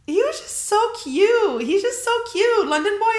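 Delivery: high, squealing gush over a cute guy. Real, not acted. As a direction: squeal it out, swooning. Gushing over London boy.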